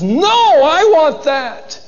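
loud, high, drawn-out acted cry; desperate protest. Son refusing to lose the blessing, loud protest. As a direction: wail it slowly at the top of the voice.

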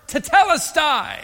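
tetelestai cried out high and slow with full strength; shouted declaration. Shown as a demonstration. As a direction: call out tetelestai as a triumphant shout of victory. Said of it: "he shouts the word".

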